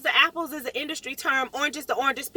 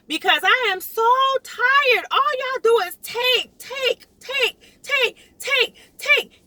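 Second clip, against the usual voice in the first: high, slow, rising cry of take, take; exasperated outcry; 9 semitones higher, slower than usual. Real, not acted. Fed-up homeowner venting at the county, rising. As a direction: let the frustration climb on each take.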